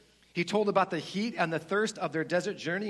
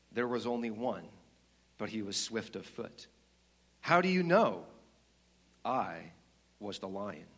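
lion's deep voice; 4 semitones lower than usual. Acted. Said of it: "the voice continued".

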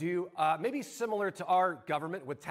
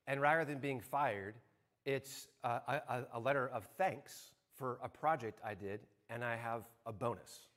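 hushed reveal; 7 semitones lower, 7 dB quieter than usual. Retold or imitated. Surprise reveal of bonus letter.

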